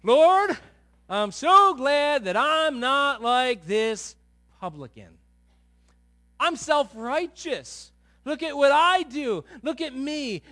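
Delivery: loud, slow, high smug prayer; boastful Pharisee. Retold or imitated. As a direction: puff up like a proud Pharisee. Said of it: "and he says".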